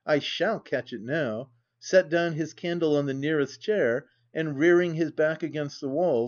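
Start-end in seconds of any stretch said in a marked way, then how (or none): none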